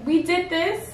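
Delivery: giddy high-pitched party talk; excited. Retold or imitated. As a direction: hype up the celebration. Excited retelling of celebrating.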